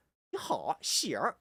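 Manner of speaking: slow mainland Mandarin with a curled r; mainland Chinese accent. Shown as a demonstration. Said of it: "from China".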